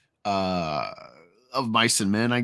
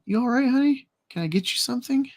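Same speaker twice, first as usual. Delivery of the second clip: waitress voice; 10 semitones higher, faster than usual. Acted.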